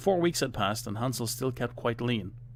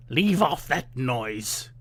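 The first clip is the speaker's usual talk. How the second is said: very slow, louder harsh witch; slow harsh witch scolding; witch calling out; 3 semitones higher, 5 dB louder, slower than usual. A narrator's reading. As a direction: sneer at her crying. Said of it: "the old witch called out".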